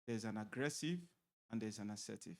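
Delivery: normal talking voice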